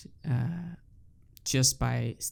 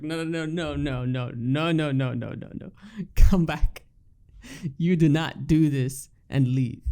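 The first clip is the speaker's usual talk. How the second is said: mock protest. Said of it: "I was like".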